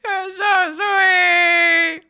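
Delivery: hammed-up sob, loud and slow; mock crying. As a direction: wail it in a mock-tearful way. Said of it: "And I was like".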